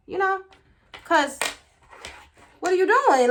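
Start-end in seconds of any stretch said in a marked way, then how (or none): 2.6-3.3 high, loud incredulous question; alarmed demand. Retold or imitated. Woman catching him FaceTiming, loud high demand. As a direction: raise the voice in disbelief.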